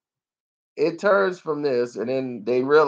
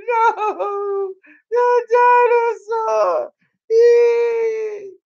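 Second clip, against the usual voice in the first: very high, drawn-out hammed-up wail; mock weeping; 3 dB louder, much slower than usual. Mimicking tearful moviegoers mourning the dinosaur, exaggerated sobbing wail. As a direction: imitate a distraught viewer wailing loudly. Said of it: "People be".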